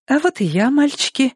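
very high, singsong female voice; high-pitched playful woman greeting the men; wife's entrance. A narrator's reading. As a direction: chirp it like a wife walking in. Narrator voices the wife entering.

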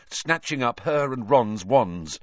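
plain reading voice

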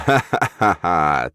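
slow, loud villain laugh; evil laugh. Acted. (злодейский хохот)

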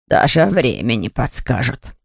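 put-on low, slow old man talking to himself; old man grumbling. A narrator's reading. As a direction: grumble slowly to yourself. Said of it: "сказал дед сам себе". (старик медленно ворчит сам себе)

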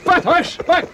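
urging shout. Acted. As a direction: yell your horse forward in the race. Racing horses on the beach. (luid en hoog het paard aanvuren)

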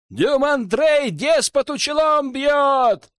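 high, slow triumphant shout. Acted. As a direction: call it out to the crowd. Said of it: "кричали в ответ".

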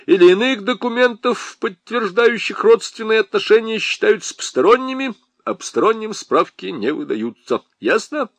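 lieutenant reciting rules to dismiss Nyura; official droning. A narrator's reading. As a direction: recite the regulation like a clerk. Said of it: "забубнил он, словно читал постановление".

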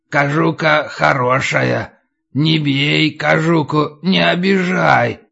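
put-on slow, creaking old crone, pleading; a frail old creature begging for mercy; creaky old voice. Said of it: "Голос скрипел, словно с ним говорил старик или старуха". (скрипучий старческий голос)

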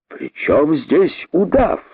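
high, stretched-out outraged question; indignant cry. Acted. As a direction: cry out as if losing composure. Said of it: "закричал находчивый".

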